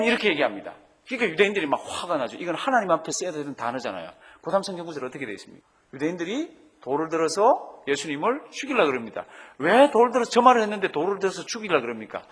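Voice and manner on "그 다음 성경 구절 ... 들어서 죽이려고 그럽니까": normal talking voice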